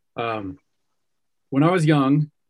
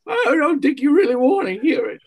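put-on high, whiny old woman voice; quavering old lady, pitched way up; 5 dB louder than usual. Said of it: "Still give me your old lady voice".

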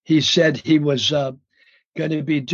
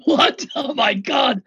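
high-pitched mock astonishment; shocked outburst; 8 semitones higher, slower than usual. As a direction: exclaim in stunned disbelief, pitched way up. Retold shock at the reveal.

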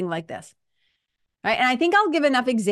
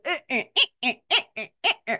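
choppy eh eh eh, lurching; jerky stop-start grunts; 3 semitones higher, much faster than usual. Shown as a demonstration. Showing the herky-jerky stop and go, choppy eh sounds. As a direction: stutter out short eh sounds like braking. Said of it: "going back and forth like".

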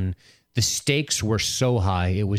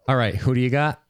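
normal talking voice

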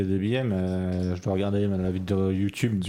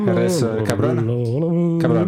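mock Spanish accent; 5 semitones higher, slower than usual. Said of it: "imitation bien raciste".

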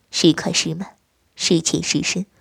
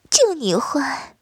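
shy laugh then slow, higher coy rebuke; slow, coy, playful reproach; coy scolding; 6 semitones higher, slower than usual. Acted. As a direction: bashfully pretend to scold. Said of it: "面带娇羞嗔道".

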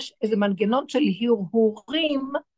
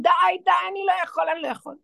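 fast, higher desperate shout of "די, די"; 6 semitones higher, faster than usual. Retold or imitated. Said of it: "ואז אתם צועקים".

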